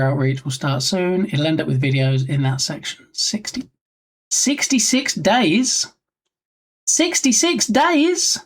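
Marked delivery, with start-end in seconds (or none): 3.1-5.9 slow rising disbelief, higher; surprised. Real, not acted. Streamer reacting to a dev's timeline, surprised. 6.9-8.4 much higher and slow, near squeak; bigger disbelief. Real, not acted. Streamer repeating the dev's timeline, astonished.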